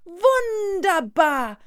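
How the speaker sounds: high, loud, long-stretched exclamation; delighted praise. Acted. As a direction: draw it out with great enthusiasm. Schoolbag praises the boy. (begeistert, hoch und gedehnt)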